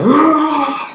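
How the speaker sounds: ordinary delivery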